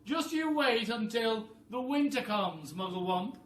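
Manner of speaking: high, loud bossy bird voice; a high, loud, clever-bird voice; roly-poly bird voice. Acted. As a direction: squawk it like a know-it-all bird. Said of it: "the roly-poly bird".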